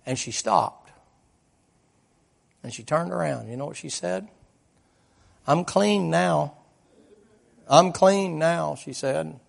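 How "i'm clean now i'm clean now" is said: slow, raised, louder proclamation; retold triumphant declaration. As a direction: stretch it out as a victorious testimony. Climax, the girl's retold triumphant declaration.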